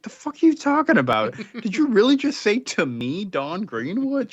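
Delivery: shrieking high "the fuck are you talking about?" in mock outrage; incredulous outburst. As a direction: mimic a teen who can't believe what she just heard. Incredulous girl retorts to summons.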